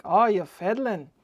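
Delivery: quoting him in a mock Irish brogue; Irish accent. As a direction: put on a typical Irish accent. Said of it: "in a typical Irish accent".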